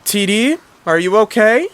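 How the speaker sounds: acted fatherly alarm; worried parent. A narrator's reading. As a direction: call anxiously to your child. Dad worried for his child.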